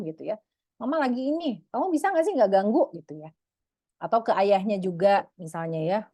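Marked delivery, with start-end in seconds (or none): 0.8-2.9 annoyed scolding. Acted. Mother scolding a disturbing child.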